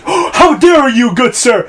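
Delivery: high, loud, hammed-up indignation; gasp, then stretch out the theatrical affront; mock outrage. Hamlet affronted by the scratch, mock outrage.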